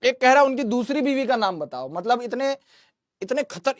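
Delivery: ordinary talk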